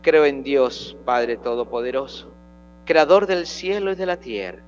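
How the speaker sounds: steady, solemn prayer recitation; reciting the Creed. Acted.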